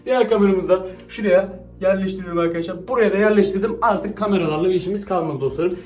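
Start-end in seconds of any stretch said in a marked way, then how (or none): none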